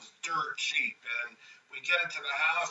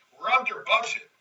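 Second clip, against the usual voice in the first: higher, pleased announcement; proud excited claim; 7 semitones higher, 4 dB louder than usual. Retold or imitated. Builder telling Pete they are under budget, higher and pleased. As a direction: crow about the budget.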